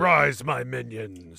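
slow, deep villain line; evil villain voice. Retold or imitated. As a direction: play the dark lord. Imitating villain trailer line.

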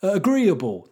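high mock garage MC chant. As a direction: hype the crowd like a put-on garage MC. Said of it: "the M C was going".

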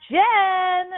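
high loud holler; dad hollering from the river; calling out. Retold or imitated.

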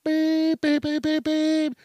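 high beeping horn voice, slow on the last words; imitating a car horn. Imitating the honking driver behind him, high and beeping. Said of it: "they're a pain in the ass".

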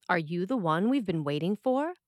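normal talking voice

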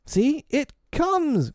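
put-on high, long-drawn boyish call; child calling out a sighting; excited cry. A narrator's reading. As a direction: cry out slowly, pitched right up. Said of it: "cried Curly".

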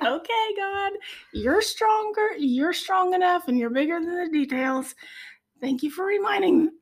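giving in to God with relief; relieved gratitude. Retold or imitated. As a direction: exclaim it like a weight just lifted. High relieved reply to God.

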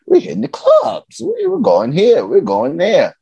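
high-pitched hype from the buddies; rally the crew for a night out; excited buddies. Retold or imitated. Buddies acted urging him out partying.

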